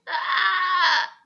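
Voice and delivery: loud wailing shriek with a laugh; banshee shriek. Acted. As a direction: shriek like a ghost.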